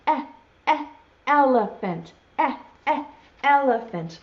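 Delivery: "eh, eh, elephant", each short "eh" standing alone; letter E sound. Shown as a demonstration. Teacher naming the animal for children, sounding out "eh, eh, elephant". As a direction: sound out two separate "eh"s before each "elephant". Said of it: "makes sort of a funny sound".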